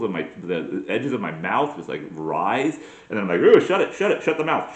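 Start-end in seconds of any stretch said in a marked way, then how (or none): 3.4-4.7 disgusted self-scolding. Acted. Scolds himself for smiling.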